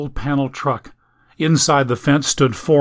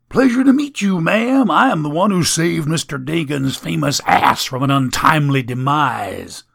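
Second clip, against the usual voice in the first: grinning rascal; 4 semitones higher than usual. Acted. Staples introduces himself to Ellie.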